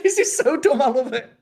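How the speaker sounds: high laughing delight. Real, not acted. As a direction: laugh through the words. Laughing delight at dumb scene.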